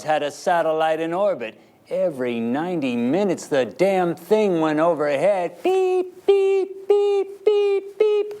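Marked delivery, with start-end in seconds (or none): 5.6-8.3 very high rising beeps; high rising beep beep noises; imitated beeping. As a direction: mimic a satellite signal. Imitating Sputnik signal.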